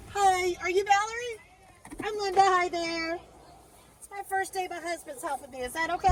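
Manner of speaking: friendly passenger greeting. Real, not acted. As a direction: greet a new customer in a high, welcoming voice.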